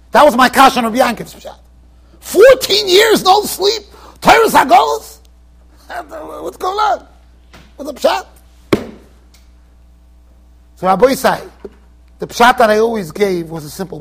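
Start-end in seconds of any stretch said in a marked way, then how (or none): 2.2-8.3 a preacher's mock astonishment; incredulous outburst. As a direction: throw up your hands in disbelief.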